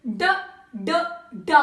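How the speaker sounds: "D" as a short drum-beat sound; phonetic D sound. Shown as a demonstration. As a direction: tap out "D" in short separate beats, not the letter name. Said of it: "What was the sound she made?".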